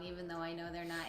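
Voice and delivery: ordinary talk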